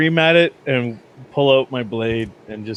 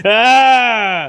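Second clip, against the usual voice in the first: sharp high scream of fright; terrified scream; 9 semitones higher, 6 dB louder than usual. Acted. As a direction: shriek in sudden terror.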